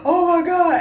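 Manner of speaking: high, loud acted wail; hammed-up desperate cry. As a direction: re-enact frantic tearful pleading into a phone. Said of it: "I'm crying".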